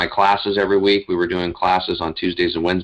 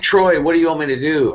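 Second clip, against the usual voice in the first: retold scream; 8 semitones higher than usual. Alone in his office, calling out to his dead brother, higher anguished cry. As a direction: call out to Troy in anguish, half-performed. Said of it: "I screamed it out".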